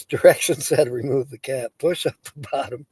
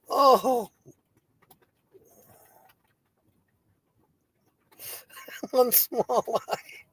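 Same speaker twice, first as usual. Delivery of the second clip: laughing; 7 semitones higher, 3 dB quieter, much slower than usual. Real, not acted. Still laughing.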